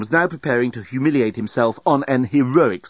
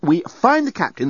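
firm, higher-pitched woman's voice; 8 semitones higher, 4 dB louder than usual. A narrator's reading. Nettie proposing a plan to Dan, firm. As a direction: lay out the plan decisively, pitched up. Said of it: "said Nettie firmly".

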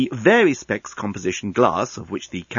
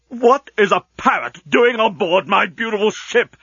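much higher, indignant demand; outraged demand; 9 semitones higher, 3 dB louder than usual. Acted. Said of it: "demanded the outraged genius".

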